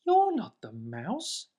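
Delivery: high, challenging little monster voice; a small monster squaring up to a stranger; Gruffalo's child. Acted. Gruffalo's child to snake, high and challenging.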